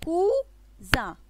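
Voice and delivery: COUSIN with a long gap, cou ... zin; syllable by syllable. Shown as a demonstration. Teacher says listen, then gives cousin in separated syllables. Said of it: "écoutez".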